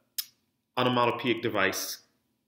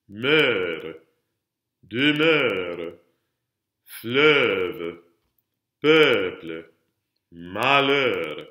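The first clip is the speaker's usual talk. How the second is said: "Meurt, demeure, fleuve, peuple, malheur" one by one, vowels swelling; isolated, drawn-out "Meurt, demeure, fleuve, peuple, malheur"; last-syllable emphasis; 4 dB louder, much slower than usual. Shown as a demonstration. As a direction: press the end of "demeure" and "malheur" as in a repeat-after-me drill. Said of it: "putting all that emphasis on the last syllable".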